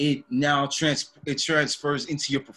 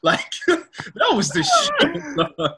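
high, slow laughing squeal; laughing hard; 4 dB louder, much slower than usual. Real, not acted. Laughing about his favourite anime scene, high and slow.

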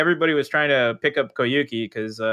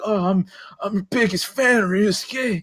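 fawning fanboy; 5 semitones higher than usual. Retold or imitated. Fanboy quote of Ryusuke.

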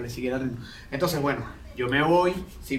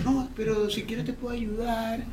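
overly polite, dainty and high; imitating the stranger's overly delicate voice; 7 semitones higher than usual. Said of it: "es muy delicada la voz y me dice".

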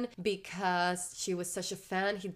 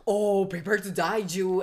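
mock threat; 7 dB louder than usual. Frightened guard threatens Matthew.

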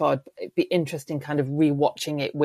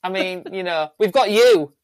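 higher, louder, laughing eager grab; mock greedy chief; 7 semitones higher, 5 dB louder than usual. To the Englishmen, the chief laughing and shouting for more boats. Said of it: "the Mohawk would go".

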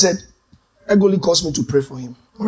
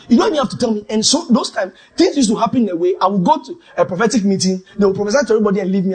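ordinary talk

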